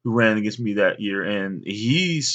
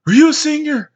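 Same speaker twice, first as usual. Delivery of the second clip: high, loud, breathless question; winded runner calling out; out of breath; 8 dB louder than usual. Retold or imitated. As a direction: gasp it out after the race. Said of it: "And he's all tired".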